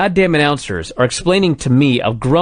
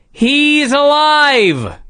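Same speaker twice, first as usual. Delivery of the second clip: high, long-drawn hammed-up cry; mock announcer scream; 10 semitones higher, much slower than usual. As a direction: stretch it out like an astonished commentator. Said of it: "so they scream, and I quote".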